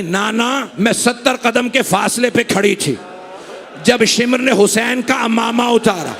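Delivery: ordinary delivery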